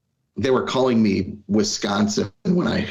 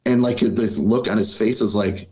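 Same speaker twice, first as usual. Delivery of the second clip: ordinary talk, nothing marked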